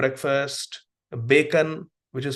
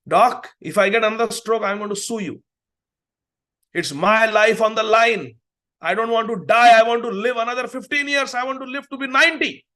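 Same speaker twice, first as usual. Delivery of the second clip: higher and louder, a desperate screaming demand; screaming patient; 5 semitones higher, 4 dB louder than usual. Retold or imitated. Old patient screams at her doctor she wants to live, loud. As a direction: shriek it like a terrified patient clinging to life. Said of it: "She screamed at me like this".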